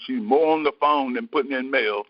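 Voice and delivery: usual speaking voice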